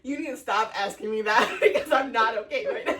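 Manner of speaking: half plead, half laugh it out. Retold or imitated. Retold tearful plea to best man.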